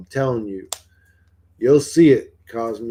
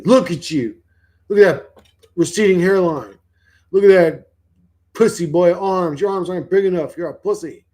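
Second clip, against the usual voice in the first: higher and louder mocking taunt; taunting demon voices; 5 semitones higher, 5 dB louder than usual. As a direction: sneer at him like a jeering demon. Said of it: "That's what demon voices say".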